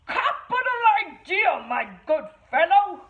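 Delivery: high, hearty gentleman pig; a pig playing the proper gentleman; jolly posh pig. Third pig agrees.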